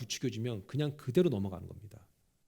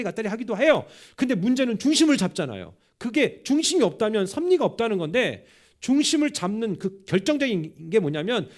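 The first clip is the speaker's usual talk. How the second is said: forceful preaching; 6 semitones higher, 7 dB louder than usual. Acted. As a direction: preach it out with full conviction. (힘 있게 외치는 설교)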